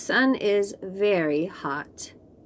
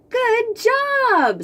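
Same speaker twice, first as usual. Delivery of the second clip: high, bright cheer with a far-off ring; 5 dB louder than usual. Acted. Bob praising Pete after his ride, high excited cheer. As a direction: shout the praise with delight.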